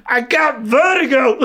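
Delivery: high, loud, drawn-out American wail; hammed-up screamed impression; mock seasick shout. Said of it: "she'd throw a bit more and she'd just go".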